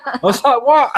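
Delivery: high laughing disbelieving What; shocked disbelief. Retold or imitated. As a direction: squeal it in stunned disbelief. Shocked at Geneva Convention rule.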